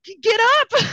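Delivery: high, loud plea at a game character; exasperated yell. Acted. Player to Yoshi lying stunned, exasperated yell. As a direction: yell at the screen in mock desperation. Acting out yelling at stuck Yoshi.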